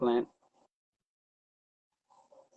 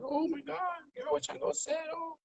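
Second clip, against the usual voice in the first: amazed gasp; 14 semitones higher, 9 dB quieter than usual. Retold or imitated. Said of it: "Everybody was like".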